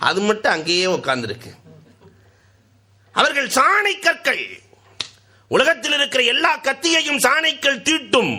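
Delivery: high, ringing sermon voice; fiery preaching. Acted. Preacher exhorting the beloved listeners, high and ringing. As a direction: preach it out to the crowd.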